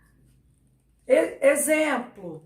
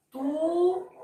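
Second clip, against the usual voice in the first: túnel split into TU, long pause, nel; held TU, then a separate nel in túnel; syllable by syllable; 3 semitones higher than usual. Shown as a demonstration. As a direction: dictate túnel in two detached syllables, as if writing it. Spelling it out while writing.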